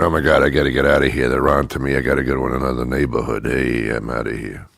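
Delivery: fast, hushed panic; fast nervous dealer bolting; dealer fleeing cops. Pretend. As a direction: hurry off before the cops arrive. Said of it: "you hear the cops and the sirens are coming".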